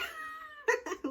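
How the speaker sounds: high giggle after own imitation; real laugh. Real, not acted. She laughs at the group's refusal, high giggle. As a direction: crack up at your own impression.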